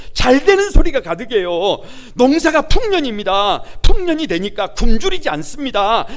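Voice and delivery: fervent preaching. Acted. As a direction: preach it out, building intensity. Preacher rising to full force.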